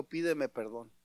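low, quiet demand; father soberly requiring an apology; understated paternal sternness; stern, serious father. Acted. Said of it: "lo ve muy serio y le dijo".

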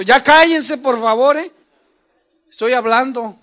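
loud, high exasperated yell; shouting at kids. Retold or imitated. Father yelling at his daughters to let him talk, loud and high. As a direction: shout them down at home. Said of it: "yo en la casa a veces grito".

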